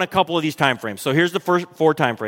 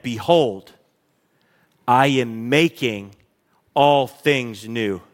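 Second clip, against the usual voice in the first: slow, lower, weighty declaration; solemn proclamation; 6 semitones lower, slower than usual. Acted. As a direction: lay each word down slowly like a decree. God's voice quoted solemnly.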